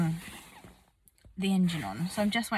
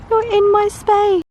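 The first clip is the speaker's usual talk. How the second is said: mock scolding; 13 semitones higher, 13 dB louder than usual. Real, not acted. Horse crowding her, laughing.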